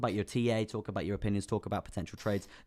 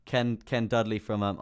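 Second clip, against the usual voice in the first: usual speaking voice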